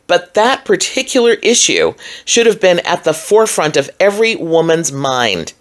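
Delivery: ordinary talk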